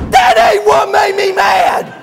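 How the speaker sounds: slow, high, furious mock bellow; hammed-up tongue-tied roar; Buddy. Buddy corrects the preacher, bellowing in worked-up rage. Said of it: "when he gets real worked up".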